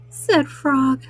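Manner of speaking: usual speaking voice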